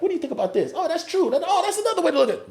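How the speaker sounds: high, eager back-and-forth; comics trading feedback. Retold or imitated. Comics test jokes together, high eager feedback.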